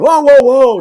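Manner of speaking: loud, high mock-panicked cry; imitated alarm at full volume; alarmed whoas. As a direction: shout it as if fending someone off. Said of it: "then he does it again".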